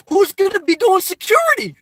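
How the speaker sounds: high-pitched, loud rhetorical demand; incredulous outburst. Real, not acted. Streamer challenging viewers on who guards the lot, incredulous shout. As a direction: shout it in exasperated disbelief.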